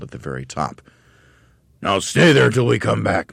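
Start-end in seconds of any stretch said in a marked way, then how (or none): 1.8-3.3 higher, louder drill bark; barked command. Acted. Mister Twit barking orders at the monkeys. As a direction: bark like a fearsome trainer. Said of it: "Mister Twit ordered".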